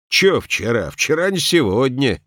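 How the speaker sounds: ordinary delivery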